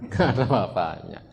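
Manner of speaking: says it through a laugh; chuckling while speaking. Real, not acted. Preacher laughing with the congregation at men.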